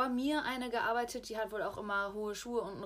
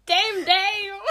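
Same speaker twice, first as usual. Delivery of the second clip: high, loud laughing exclaim; laughing shout; 11 semitones higher, 15 dB louder than usual. Real, not acted. Reacting to starfish joke.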